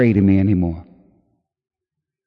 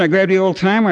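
ordinary talk

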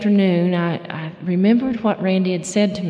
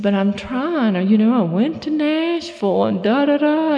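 higher, swooping sing-song excuse; retold pleading protest; 6 semitones higher, 4 dB louder than usual. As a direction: plead your case, climbing into a mock rattled-off list. Retells her drunken plea to friend.

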